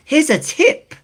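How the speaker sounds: high, rising sing-song tip call; high announcer voice. Pretend. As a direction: call it out brightly.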